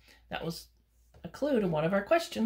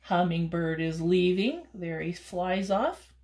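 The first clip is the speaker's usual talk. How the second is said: plain reading voice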